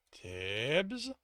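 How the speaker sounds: tentative, rising question; wary, questioning address; cautious. A narrator's reading. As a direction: sound him out carefully, voice lifting at the end. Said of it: "Don said cautiously".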